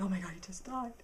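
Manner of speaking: hushed, stunned disbelief; stunned shock. Retold or imitated. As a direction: gasp it out quietly in shock. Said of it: "I was like".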